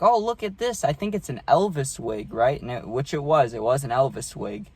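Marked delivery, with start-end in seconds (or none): 0.0-2.3 grandma voice. Retold or imitated. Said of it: "She's like".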